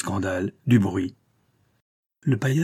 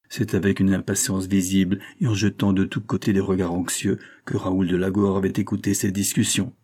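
usual speaking voice

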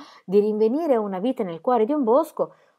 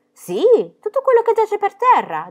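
put-on high, bright girl's voice; a confident little girl, pitched up; Pippi; 14 semitones higher, 6 dB louder than usual. A narrator's reading. Pippi answers Annika about finds, high and sure. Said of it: "disse Pippi".

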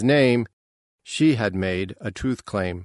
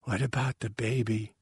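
soft, weak voice; weakly; 7 dB quieter than usual. A narrator's reading. Mother uneasy asking father about baby, weakly. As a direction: let the question come out faint. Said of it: "she said weakly".

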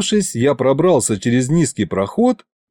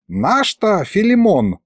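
slow, higher-pitched musing; pensive drawl; 8 semitones higher, slower than usual. A narrator's reading. Sorcerer recalls an old acquaintance, pensive drawl. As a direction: draw it out thoughtfully. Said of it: "задумчиво протянул Мировид".

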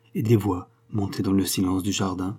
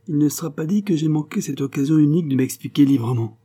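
higher, fired-up outburst of resolve; exclaiming; 7 semitones higher, 3 dB louder than usual. A narrator's reading. Joseph, turning back at the station, exclaims in a burst. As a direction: cry it out in sudden rage. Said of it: "s'était-il écrié".

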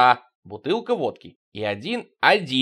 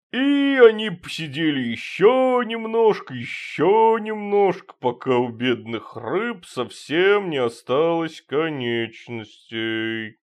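put-on slow slurred drunken drawl; town drunk; 4 semitones higher, slower than usual. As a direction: drone like a drunk at the table. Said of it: "Васильев басом пьяно". (пьяный бас)

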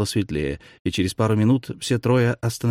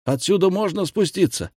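shouted much higher over the din; yelling over roaring water; 10 semitones higher than usual. A narrator's reading. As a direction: pitch it up to carry over the falls. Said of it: "проорал Ирт".

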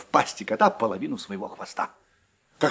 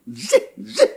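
high cross mouse squeak; angry squeak; 6 semitones higher, 5 dB louder than usual. A narrator's reading. Angry mouse squeaking back at her friend. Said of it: "рассердилась Длиннохвостка".

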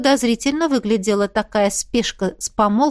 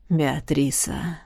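tender grandfather; 7 semitones lower, 5 dB quieter, much slower than usual. Acted.

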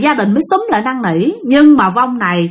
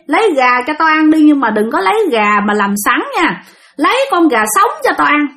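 high, demanding spirit speaking through a woman; possessed, different voice; 6 semitones higher than usual. Retold or imitated. Spirit in the aunt refusing a bath, possessed voice. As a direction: pitch it up, a brusque possessing spirit. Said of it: "với một cái giọng rất là khác".

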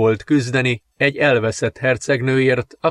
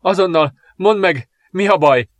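panting in pain; 7 semitones higher, 3 dB louder than usual. A narrator's reading. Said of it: "zihálva fájdalmában".

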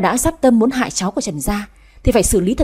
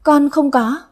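higher, hesitant plea; frightened denial; 5 semitones higher, slower than usual. A narrator's reading. Maid questioned by the master, frightened denial. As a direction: deny it haltingly, afraid. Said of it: "lắp bắp nói".

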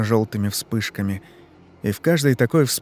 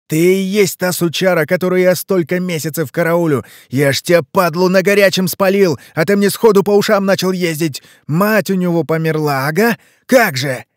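high, loud raging tirade; ranting fury; 7 semitones higher, 6 dB louder than usual. Acted. Leshy raging at Ivan for lying, high and loud. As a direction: unload every insult at him.

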